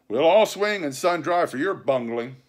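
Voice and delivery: angry retort. A narrator's reading. Mutineer blaming the captain for bungling, angry. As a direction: throw the blame back at him.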